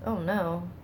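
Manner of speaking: normal talking voice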